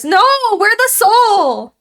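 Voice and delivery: slow, loud, high protest; protesting the game's twist; indignant outcry. Real, not acted. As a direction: drag it out in disbelief. Outraged protest at game twist.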